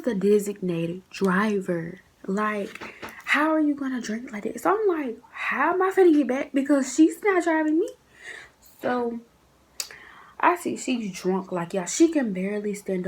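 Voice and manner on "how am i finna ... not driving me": high, louder worried question. Retold or imitated. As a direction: fret about the ride, pitched up. Panicking about ride home.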